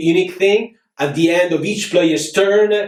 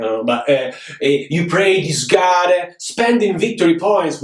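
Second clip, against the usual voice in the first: normal talking voice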